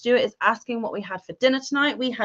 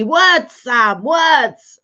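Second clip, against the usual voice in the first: loud, higher call of the chat's demand; mock shout; 4 semitones higher, 9 dB louder, much slower than usual. Host reading a viewer's shouted chat, mock yelling. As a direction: shout it across the room. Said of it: "Amanda's shouting at me".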